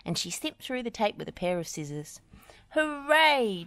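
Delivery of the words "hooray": high loud cheer. A narrator's reading. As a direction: cheer together. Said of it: "cheered the machines".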